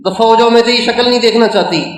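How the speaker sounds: imitating a mother's angry dismissal. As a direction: rattle it off like a furious mother. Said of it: "وہ غصے میں اتنی بھری ہوئی ہے".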